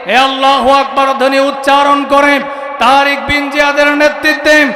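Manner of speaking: building from steady to high shouting sermon; preaching at full force. Real, not acted. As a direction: rouse the crowd, climbing to a roar. Fiery sermon shouting again.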